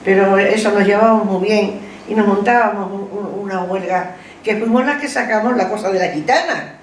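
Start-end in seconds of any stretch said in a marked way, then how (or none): none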